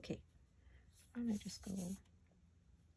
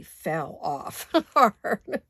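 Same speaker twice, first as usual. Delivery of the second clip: laughing; 9 dB louder, much slower than usual. Real, not acted. Laughing at crafting mishap.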